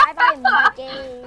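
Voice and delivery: high, loud, slow taunting farewell; gloating. Real, not acted. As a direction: sing it out as a gloat. Gloating after a kill.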